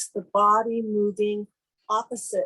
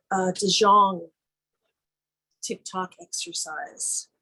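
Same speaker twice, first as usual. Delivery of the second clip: usual speaking voice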